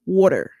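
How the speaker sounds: "water" with a Baltimore vowel and a full R; Baltimore-style "water", R sounded at the end; Baltimore accent. Shown as a demonstration. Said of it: "people from, like, Baltimore".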